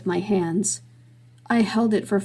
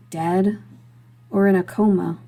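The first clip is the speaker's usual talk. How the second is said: usual speaking voice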